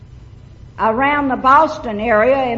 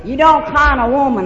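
loud; 4 semitones higher, 7 dB louder than usual. Real, not acted. Emphatic punchline to AA crowd.

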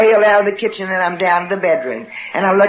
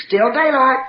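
usual speaking voice

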